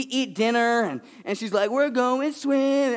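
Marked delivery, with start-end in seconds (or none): 1.7-2.9 put-on high, slow child's voice; eager little girl. Daughter voiced.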